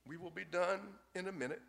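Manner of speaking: put-on soft, calm hush to a child; father quietly calming his screaming son. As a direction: quietly soothe the fussing boy. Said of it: "the father was quietly saying".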